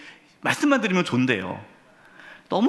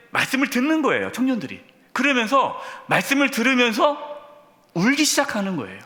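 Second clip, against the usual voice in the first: excited; 7 semitones higher than usual. Real, not acted. Sermon building to excitement.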